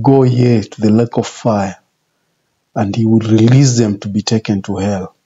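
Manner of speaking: ordinary talk, nothing marked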